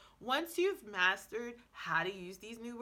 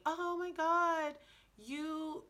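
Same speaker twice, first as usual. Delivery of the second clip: higher, drawn-out impressed gush; imitated amazement; 7 semitones higher, much slower than usual. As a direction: imitate someone amazed, slow and high. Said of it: "I have impressed so many people".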